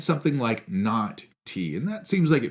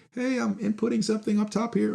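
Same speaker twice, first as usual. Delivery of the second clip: voicing a little symbol; 11 semitones higher than usual.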